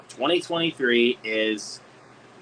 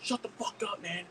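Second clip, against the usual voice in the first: mock angry comeback; 13 semitones higher, much slower than usual. Heckler firing back at a fan, mock angry. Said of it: "fire it back and be like".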